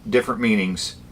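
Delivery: ordinary talk, nothing marked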